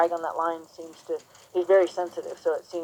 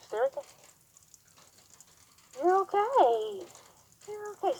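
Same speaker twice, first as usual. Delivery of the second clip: soothing praise to horse; 12 semitones higher than usual. Real, not acted. Calming the horse.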